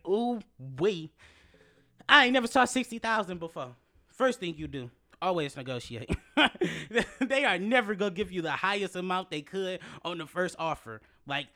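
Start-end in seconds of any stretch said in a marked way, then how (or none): 0.1-3.7 high, loud amazed exclaim; amazed ooh wee. Retold or imitated. Amazed at the offer. 6.1-7.1 high loud burst of laughter. Real, not acted. Laugh after advice.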